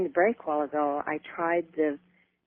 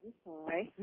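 faint caller off the mouthpiece; speaking away from the phone; phone held away; 14 dB quieter than usual. Real, not acted. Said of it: "talk into the phone".

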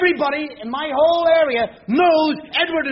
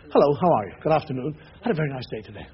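put-on polite young man; 11 semitones lower, 4 dB quieter, faster than usual. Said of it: "they learn to speak English perfectly".

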